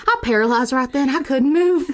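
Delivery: hammed-up Southern witness; Southern accent. Improvised witness telling of being paralyzed, Southern accent. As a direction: play a rattled Southern witness. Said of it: "If you like our Southern accent".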